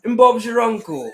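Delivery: hammed-up British catchphrase; mock British accent. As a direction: play it like a cheeky Londoner. Echoes British catchphrase, louder.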